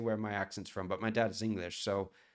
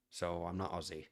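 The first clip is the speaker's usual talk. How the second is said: ordinary talk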